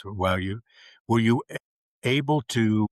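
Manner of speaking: ordinary talk, nothing marked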